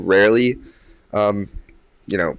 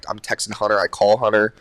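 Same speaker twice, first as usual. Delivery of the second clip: ordinary talk, nothing marked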